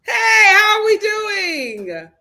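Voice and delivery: drawn-out shouted hello, high and slow; big loud greeting. Real, not acted. Host calling out to her viewers, loud and slow. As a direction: belt the greeting to the whole chat.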